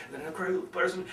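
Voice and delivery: suddenly hushed, hammed-up; crazy person. Shown as a demonstration. Speaker mocking forced vocal variety, sudden crazy hush. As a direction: drop abruptly to a near whisper. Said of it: "sound like a crazy person".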